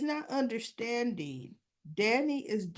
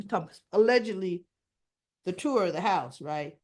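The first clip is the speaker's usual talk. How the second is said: ordinary talk, nothing marked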